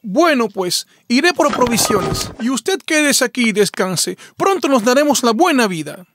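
high, fussing, motherly fox voice; fussing over a hungry husband; doting fox. Acted. Fox to her husband, fussing tenderly.

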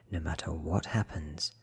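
plain reading voice

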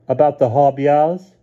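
put-on high, slow child's voice with a rising tune; a child savouring a spooky story name; little boy. A narrator's reading. Stevie telling Lynn what stories he heard, slow and high.

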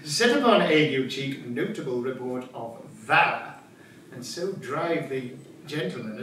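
usual speaking voice

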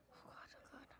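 terrified breathless whisper; scared whisper. Real, not acted. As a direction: whisper it in fear. Hiding from animatronic, scared.

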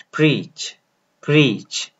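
प्रीच slow with long ee; model pronunciation. Shown as a demonstration. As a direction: say प्रीच slowly, stretch the ee. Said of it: "pronounce करें". (प्रीच, लंबी ई के साथ धीरे)

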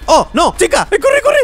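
very high frantic shouting; high, loud terrified urging to run; panicked yell. Real, not acted. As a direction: scream at yourself to run, real panic. Chica appears and chases him.

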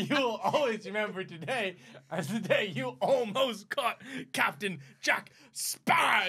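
hammed-up pirate, stretching out the final name; Jack Sparrow. Showing how the pirate's farewell should go, grand hammed-up voice. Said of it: "Any Johnny Depp".

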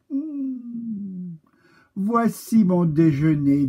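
high crow voice, greedy hum; a hungry crow spots its lunch. Acted. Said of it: "dit le corbeau".

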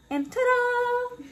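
drawn-out high ta-da reveal; excited ta-da. Real, not acted. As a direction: present it like a magic trick. Dish reveal, big flourish.

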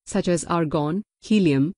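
ordinary delivery

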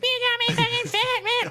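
very high, hammed-up whine; mock girlfriend complaint. Boyfriend voicing girlfriend blaming him, high mock whine. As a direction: playfully whine it as the offended girlfriend. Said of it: "she was like".

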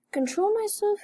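high, lightly voiced mock yell. Fern protesting to her father, high mock yell. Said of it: "yelled Fern".

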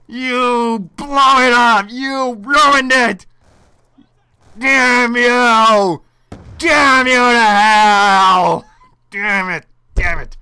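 slow, drawn-out yelling at the enemy; real rage, loud and stretched; furious shout. Real, not acted. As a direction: scream damn you to hell at him. Cursing the enemy who wrecked it.

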